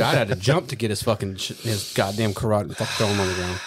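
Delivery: ordinary delivery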